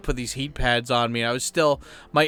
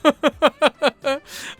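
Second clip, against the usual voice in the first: real high-pitched burst of laughter; squealing laugh at his own joke; 12 semitones higher, 4 dB louder than usual. Real, not acted. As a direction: laugh out loud, high and bright.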